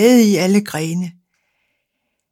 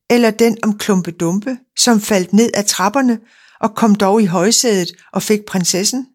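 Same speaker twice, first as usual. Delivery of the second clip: plain reading voice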